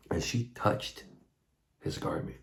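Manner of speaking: whispered, dropping to breath; hushed whisper. A narrator's reading. Preacher telling the healing, whispered and hushed. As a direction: breathe it out like a held secret.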